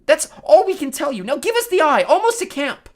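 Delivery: high, loud pleading shout; desperate demand. A narrator's reading. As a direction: plead loudly for the eye back. Sister desperately bargains for eye.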